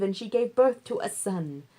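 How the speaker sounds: usual speaking voice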